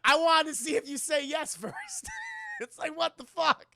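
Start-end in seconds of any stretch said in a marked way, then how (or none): 0.0-1.8 high, loud line half shouted through laughter; laughing shout. Acted. Doctor admits the trick to Steve, laughing shout. 1.8-2.5 high burst of laughter; shrieking laugh. Real, not acted. Streamer cracking up at the movie, shrieking laugh.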